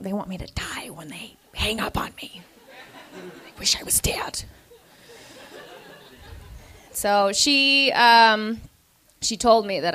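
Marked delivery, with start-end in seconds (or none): 0.0-4.4 whispered, hopeless and low; mock despairing whisper. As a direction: whisper it like you've given up. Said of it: "And I was like".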